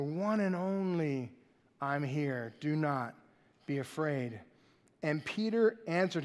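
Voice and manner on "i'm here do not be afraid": reassuring. Acted. Jesus reassuring frightened disciples.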